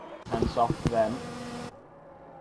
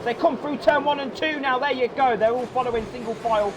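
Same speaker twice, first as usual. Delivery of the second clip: high, shouted race commentary; live play-by-play; 14 semitones higher than usual. Real, not acted. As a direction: call turn one chaos at full pitch.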